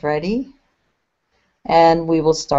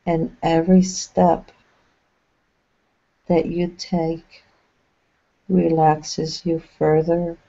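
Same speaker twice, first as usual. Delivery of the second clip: unhurried guide voice with long pauses between phrases; measured, spacious phrases, leaving silence to settle; guided meditation. Acted. Channeling a meditation for listeners relaxing along a path, guided meditation.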